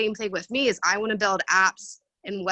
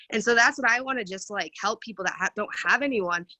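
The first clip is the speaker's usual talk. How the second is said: ordinary delivery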